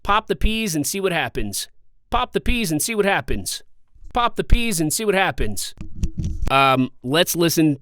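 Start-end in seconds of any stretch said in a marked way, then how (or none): 0.0-5.7 high-pitched punch on "Pop" and "peas"; exaggerated popping P's. Shown as a demonstration. Vlogger repeating a plosive test line, exaggerated popping P's. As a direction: hit "Pop the peas" with bursting P's each time. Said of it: "I'm exaggerating by popping here".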